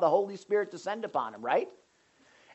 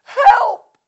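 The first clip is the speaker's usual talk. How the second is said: screamed plea, shooting far up in pitch; shrieking; 14 dB louder than usual. Acted. Prophet screaming to the Lord for help, shrieking. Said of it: "I'm shrieking".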